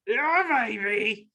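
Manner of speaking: hammed-up movie spy impression; badly faked groovy spy voice; Austin Powers. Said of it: "real life Austin Powers villain".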